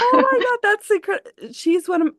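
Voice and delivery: excited gushing. Real, not acted. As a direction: gush over a shared favorite author. Gushing over a shared favorite author.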